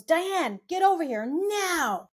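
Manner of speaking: high, drawn-out scolding call; stern reprimand. Retold or imitated. Speaker retelling how a mentor summoned her, stern reprimand. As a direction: scold like a parent reprimanding a child. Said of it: "Like you reprimand a child".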